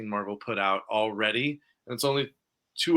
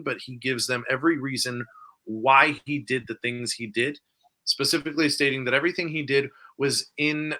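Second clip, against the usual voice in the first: ordinary talk, nothing marked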